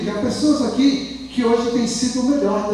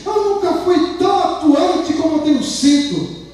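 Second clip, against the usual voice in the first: raised, driving sermon delivery; fervent preaching; 4 semitones higher than usual. Acted. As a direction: exhort the congregation, building through each repetition.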